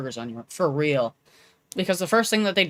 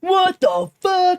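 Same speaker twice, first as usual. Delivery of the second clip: shocked squawk; 11 semitones higher, 4 dB louder, much slower than usual. Acted. Mock outrage at the name.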